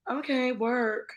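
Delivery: high, slow, drawn-out approval; retold approval. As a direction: stretch it out like cheering a friend on. Quotes own admiring reaction.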